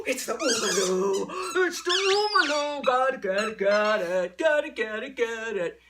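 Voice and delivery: loud, whooping hype for a dog; excited reveal. Shown as a demonstration. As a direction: burst out loudly, thrilled by the toy. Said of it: "get him excited about something else".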